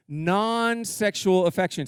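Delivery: non-sexual said slowly and heavily; slow, deliberate stress; slow emphasis. Shown as a demonstration. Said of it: "Let me say that one more time".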